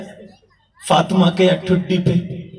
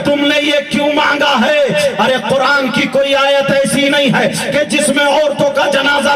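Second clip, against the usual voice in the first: raised preaching; 7 semitones higher, 4 dB louder than usual. Acted.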